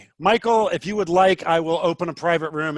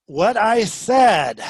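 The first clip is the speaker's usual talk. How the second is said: slow, drawn-out exasperated shout; higher, louder, dragging each word out; frustrated; 5 semitones higher, 7 dB louder, much slower than usual. Real, not acted. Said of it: "and now I'm getting frustrated".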